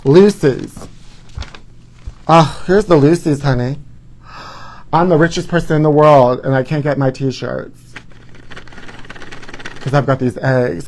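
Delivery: usual speaking voice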